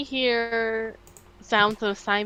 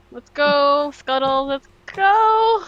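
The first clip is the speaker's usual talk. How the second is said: excited cheer; 4 semitones higher, 6 dB louder, slower than usual. Acted. Urging on companion Scuttle.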